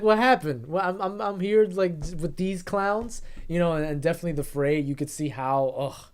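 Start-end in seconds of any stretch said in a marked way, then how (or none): 0.0-3.2 high, loud complaint, slowing on clowns; annoyed sellsword. Retold or imitated. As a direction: grumble like a cheated hireling. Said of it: "It's like".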